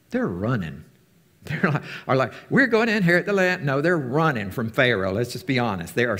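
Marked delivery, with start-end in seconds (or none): 2.5-3.7 mock boast. Mocking Israelites' naive boast.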